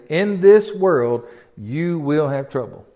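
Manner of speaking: slow, weighty quote; emphatic. Retold or imitated. Preacher quotes Jesus to his congregation, slow and weighty. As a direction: lay down each word slowly. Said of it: "Jesus said emphatically".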